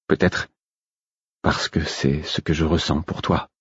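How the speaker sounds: soft, understated solemn confession; grave. A narrator's reading. Lover confesses his feelings to Molly, grave. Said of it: "dis-je d'un ton grave".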